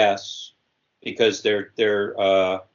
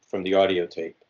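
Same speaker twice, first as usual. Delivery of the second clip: ordinary talk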